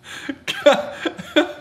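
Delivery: high, breathless cackle; real wheezing laughing fit; helpless laugh. Real, not acted. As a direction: lose it completely after telling the story. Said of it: "without laughing".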